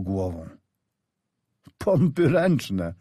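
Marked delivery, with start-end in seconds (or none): 1.8-2.9 high, slow called-out command; mild mock shout of an engineer at work; shouted order. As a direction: call it out to the platoon across the field. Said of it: "krzyknął".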